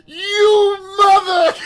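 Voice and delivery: high, loud, slow outrage, half laughing; outraged yell. Retold or imitated. Kid cursing his two-faced friend, slow high shout. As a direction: drag out the insult in a high shout.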